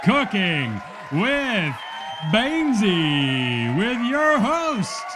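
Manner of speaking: grand show-open announcer, stretched and booming at the name; game show announcer. Acted. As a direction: build it up like a TV show host.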